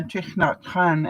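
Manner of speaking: ordinary talk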